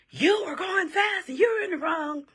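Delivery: high, loud mock shout; imitated angry yelling. Man from the passenger side accusing the driver, screaming and yelling. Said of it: "screaming and yelling".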